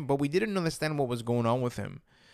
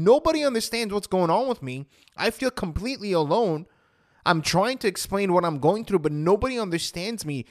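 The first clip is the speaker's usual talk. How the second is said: higher, emphatic retold anguish; distressed protest; 5 semitones higher, 4 dB louder than usual. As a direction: re-enact feeling completely misunderstood. Retold despair at not being understood.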